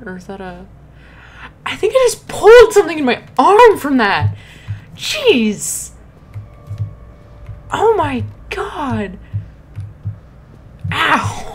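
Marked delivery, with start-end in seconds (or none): 1.7-9.2 loud, high aftershock of a scare; worked-up, higher-pitched outburst; shaken exclaiming. Real, not acted. Rattled after scare, arm hurt. 10.9-11.4 loud, high yelp of pain; short raised cry of hurt. Real, not acted. Pulled arm after scare.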